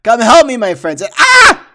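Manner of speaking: desperate shout for help; frantic loud cry for help. Acted. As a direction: yell out to your friends in panic. Villain calls for help, attacked.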